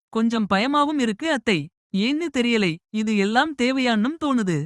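lightly voiced bride, hesitant; young bride voice. Bride confiding her worry to her aunt, mildly anxious.

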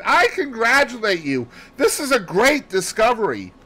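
high, loud congratulation; elated, ringing outburst; bursting excitement. A narrator's reading. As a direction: exclaim it as pent-up excitement breaks out. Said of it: "My pent-up excitement burst forth".

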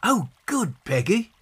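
put-on high, pleased child's exclamation; delighted cry. A narrator's reading. John praising his sister's idea, delighted high cry. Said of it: "cried John".

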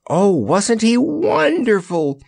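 put-on slow, high, gushing girl's voice; a high, slow admiring coo. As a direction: gush with delight over the hero. Jellia gushes over the Lion.

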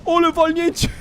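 very high squealing panic; frantic high-pitched gamer shriek; panicked urging. Real, not acted. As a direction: squeal as the big wave closes in. Fleeing a big tsunami.

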